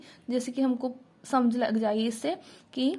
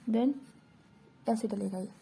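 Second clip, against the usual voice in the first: whisper; 2 semitones lower, 7 dB quieter than usual. Real, not acted.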